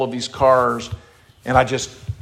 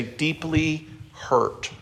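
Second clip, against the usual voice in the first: slow heavy word; 3 semitones higher, 6 dB quieter, much slower than usual. Shown as a demonstration. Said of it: "Everybody say".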